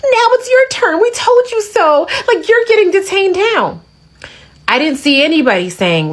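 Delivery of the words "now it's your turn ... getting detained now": very high, gleeful taunting imitation; exaggerated excited gloating, pitched way up; mock glee. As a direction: mimic gloaters in a squeaky high voice. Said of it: "people excited and gleeful".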